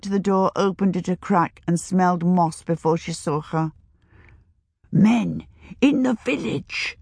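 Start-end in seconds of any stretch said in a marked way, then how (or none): 4.9-7.0 high, slow, dramatic crone hush; dramatic old woman hush; old woman whispering. A narrator's reading. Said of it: "the old woman whispered dramatically".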